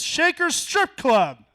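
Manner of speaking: high, loud and very slow; slow punchline reveal. Real, not acted. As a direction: spell out the sign slowly for the punch.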